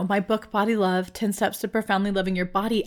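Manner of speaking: ordinary talk, nothing marked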